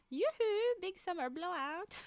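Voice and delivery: high, sing-out mock Icelandic accent; guessed Icelandic accent. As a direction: call out in a silly Icelandic accent. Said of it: "The best guess I have".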